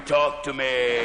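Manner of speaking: very low, slow beckoning voice; talking toilet. Acted. Toilet beckoning the drunk, deep drawn-out call. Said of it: "the old toilet in the corner going".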